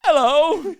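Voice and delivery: very loud, high British greeting; mock British accent. Tourist suddenly turning British at Epcot, loud mock British accent. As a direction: belt out a hammed-up British hello. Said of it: "like, British all of a sudden".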